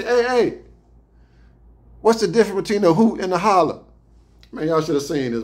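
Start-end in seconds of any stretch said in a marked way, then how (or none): 0.0-3.8 higher, louder mock-curious ask; hammed-up, wide-eyed question; mock innocent question. Asking a country guard about the contest, mock curious.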